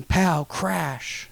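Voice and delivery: usual speaking voice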